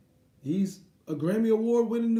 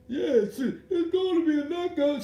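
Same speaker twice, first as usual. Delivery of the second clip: mocking commentator; 8 semitones higher than usual. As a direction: parody the pundit's empty prediction. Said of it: "Porter saying some".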